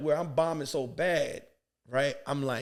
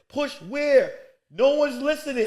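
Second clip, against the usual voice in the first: exasperated retort; 7 semitones higher, 5 dB louder, slower than usual. Retold or imitated. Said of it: "I said".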